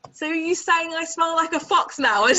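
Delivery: high, loud, affronted question; mock indignation. Man answering the owner's fox excuse, comically indignant.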